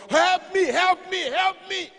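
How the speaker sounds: loud, very high mock cries for help; hammed-up panicked shouting; nightmare cries. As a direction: yell out as if waking in terror. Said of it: "I had a nightmare".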